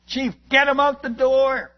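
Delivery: yelled command. Retold or imitated. Missionary yelling at the chief to get the pig out, loud shout. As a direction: shout across the chaotic church.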